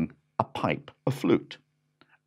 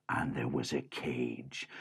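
ordinary delivery, nothing marked

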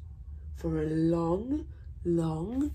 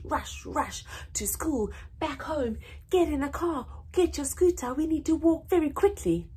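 hurried; 7 semitones higher, faster than usual. Shown as a demonstration. Hurried rushing routine acted out.

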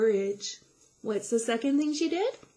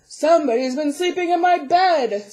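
loud, booming great big bear; Papa Bear voice; 5 semitones higher, 10 dB louder than usual. A narrator's reading. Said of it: "said Great Big Papa Bear".

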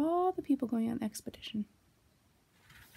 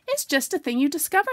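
higher, louder, offhand explanation; careless; 8 semitones higher, 8 dB louder than usual. A narrator's reading. Christopher Robin explains while unsure himself, carelessly. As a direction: toss it off as if you knew. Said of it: "said Christopher Robin carelessly".

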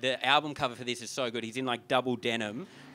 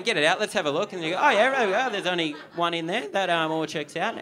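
higher and louder hammed-up enthusiasm; mock party excitement; 4 semitones higher, 8 dB louder than usual. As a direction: cheer it on like a crowd at a party. Mock party crowd inspecting.

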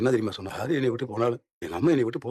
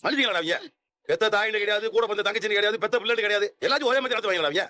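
indignant tirade; 9 semitones higher than usual. Acted. Indignant tirade about cruel family.

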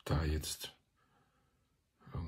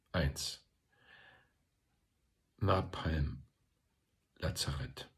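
solemn poem reading. A narrator's reading. Reciting Hilde Domin's war poems to listeners, solemn reading. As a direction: read it line by line, letting each phrase stand alone.

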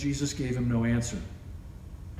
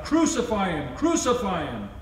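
loud crowd cry, the first a bit higher; shouted demand; 6 semitones higher, 4 dB louder than usual. Acted. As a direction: call for his death, loud and firm. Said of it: "they shouted".

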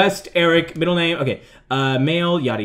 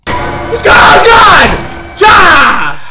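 very high, loud shriek; jump-scare scream; 12 semitones higher, 11 dB louder, much slower than usual. Real, not acted. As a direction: scream out in sudden fright. Jump scare as killer attacks.

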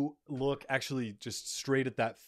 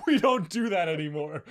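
laughing disbelief; 10 semitones higher, 7 dB louder than usual. Real, not acted.

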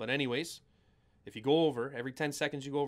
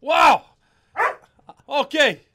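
pumped-up shout; 11 semitones higher, 15 dB louder, much slower than usual. Real, not acted. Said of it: "We're all pumped up about that".

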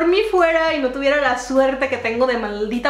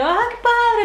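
high, enthusiastic reaction; delighted; 10 semitones higher, slower than usual. Retold or imitated. Answering the Mexicans from Puebla, delighted. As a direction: coo with delight, voice way up. Delighted reply to new friends.

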